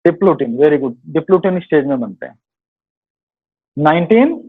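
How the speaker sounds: ordinary delivery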